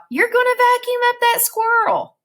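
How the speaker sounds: very high, urgent yell; shouted warning. A narrator's reading. Flora quotes her own warning to herself, shouted. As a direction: shout the whole line high. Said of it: "you would shout".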